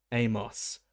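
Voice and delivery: ordinary delivery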